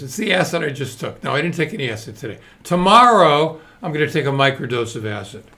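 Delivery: normal talking voice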